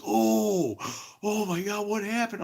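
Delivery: high, alarmed voice; imitating the friend's shock. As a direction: pitch it up in dismay. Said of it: "he goes".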